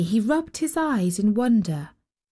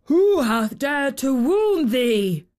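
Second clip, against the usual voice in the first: slow, higher cry of outrage; giant's angry cry; 4 semitones higher, 3 dB louder, slower than usual. A narrator's reading. Giant demanding who hurt the child, drawn-out angry cry. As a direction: cry it out slowly as an indignant giant. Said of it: "cried the giant".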